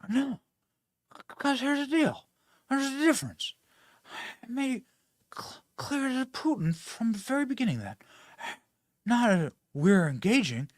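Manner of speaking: put-on high, far-off rambling old president, spiking loud on Delaware; mock the president's mumbling, half-whispered then blurting; poorly done Joe Biden impression. Host reading a quote to mock the president, hammed-up old man voice. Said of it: "in my very poorly done Joe Biden impression".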